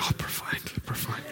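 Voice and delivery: whispered in awe. Retold or imitated. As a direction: breathe profound, profound. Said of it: "he was like".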